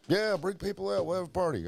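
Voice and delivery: high, upbeat quoted invite; bright eager voicing of the host; quoted friend. To friends, inviting them over, eager lift.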